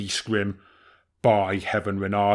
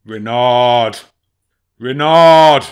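calling out; 14 dB louder, much slower than usual. Acted. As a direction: shout the name, building. Bear calling the fox.

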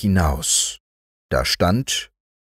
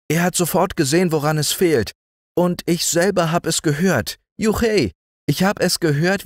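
put-on higher, eager boy's voice, at times quick; earnest boy messenger; 9 semitones higher than usual. Boy bringing good news from his doctor father. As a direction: reassure the family, bright and eager.

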